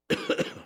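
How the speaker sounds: cough. Real, not acted. Speaker coughs mid-sermon.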